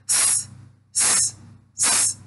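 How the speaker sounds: س drawn out slowly as a voiceless sss; slow soft sss; slow isolated s hiss. Shown as a demonstration. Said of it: "چنین تلفظ می شود".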